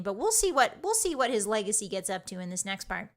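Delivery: normal talking voice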